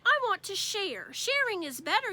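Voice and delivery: put-on a kid arguing his case; lightly voiced child whine; whiny little boy. Fudge arguing with Mom.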